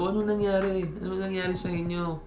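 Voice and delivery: a hint of a gossiping friend asking what happened; nosy girlfriend. Girl's friend prying for the story, lightly voiced high and soft. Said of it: "Kunyari, nagkwentuhan sila".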